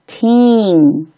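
put-on teen with the ee held long. Shown as a demonstration. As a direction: say teen drawn out, twice. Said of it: "它发长元音E".

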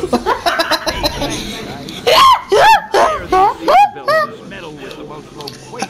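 high howling laughter; shrieking laugh. Real, not acted. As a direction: scream with laughter.

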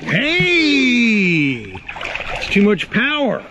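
loud high yelp of surprise; surprised shout. Real, not acted. Owner yelping as her dog yanks the toy away, loud high yelp.

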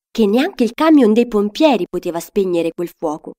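ordinary delivery, nothing marked

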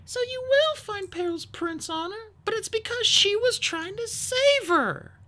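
very high, earnest character voice; subdued Sunny. A narrator's reading. Sunny defends Peril to hostile Skywings, subdued. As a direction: plead the case without raising volume. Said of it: "her voice more subdued than usual".

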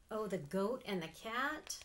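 plain reading voice

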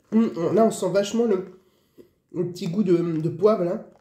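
chewing while talking; speaking between bites; mouth full of chips. Real, not acted. Seen 4: hand at mouth, chewing chips from bag while speaking throughout.

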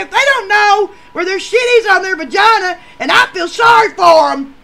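high, loud scornful yell; shouted scorn; loud rant. Retold or imitated. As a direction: holler it at her. Ed yelling at date.

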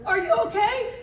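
high, alarmed question, acted out; freaked out. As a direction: call out in mock panic to someone who fell. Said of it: "I was freaked out".

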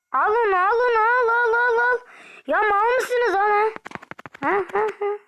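high, loud urgent commands; shouting orders. Real, not acted. Yelling at teammates to grab the enemy. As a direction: bark at teammates to take him.

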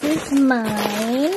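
ordinary talk, nothing marked

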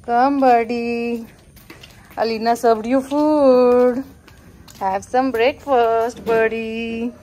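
loud, drawn-out call to the birds; sing-song call, voice carried across the garden; coaxing the birdie to come eat. Real, not acted. Calling birds to breakfast.

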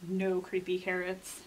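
plain reading voice

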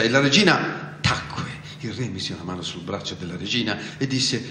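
plain reading voice

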